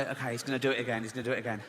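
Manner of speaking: quick, low anxious mutter under the breath. Retold or imitated. At the table, bracing for the busboy, fast anxious mutter. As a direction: rush it out, dreading the busboy's return.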